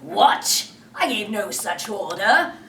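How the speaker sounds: loud indignant cry; captain catching a lie; outraged Hook. Acted. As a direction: bark it in outrage.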